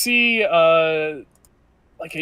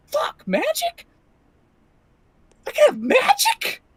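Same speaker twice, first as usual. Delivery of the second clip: an excited squeal over magic beans; thrilled squeal. Acted. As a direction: gasp at the idea of magic.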